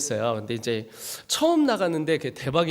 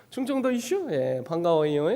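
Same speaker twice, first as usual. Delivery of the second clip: lilting Chungcheong greeting; Chungcheong accent; 2 semitones higher than usual. Acted. Preacher greeting those who guessed option three, Chungcheong accent. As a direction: greet them like a Chungcheong local. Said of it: "그다음에 충청도예요".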